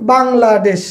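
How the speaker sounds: "Bangladesh" measured out in three beats; three-syllable demo. Shown as a demonstration. Teacher giving a trisyllable example, "Bangladesh" in three parts. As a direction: say "Bangladesh" carefully as বাং-লা-দেশ. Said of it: "তিনবারে উচ্চারণ করা যায়".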